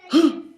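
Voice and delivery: sharp, loud, high gasp; alarmed gasp. Acted. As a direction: suck in breath at sudden danger. Cub smells approaching hunter.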